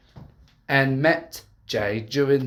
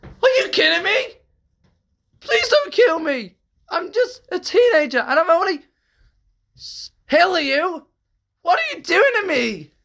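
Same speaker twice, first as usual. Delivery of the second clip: high, louder terrified pleading; screaming in horror; 4 dB louder than usual. Acted. Uzi begging the villain not to kill her. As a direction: plead for your life in panic. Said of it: "Uzi screamed in horror and scared".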